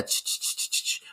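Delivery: hissed, voiceless rattle noise; imitated snake rattle. Podcaster mimicking the film's rattle sound effect, imitated rattle. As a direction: make a soft rattlesnake rattle. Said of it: "like, this rattle sound".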